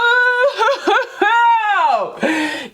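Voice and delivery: burst of laughter. Real, not acted. Reacting to a tarot card.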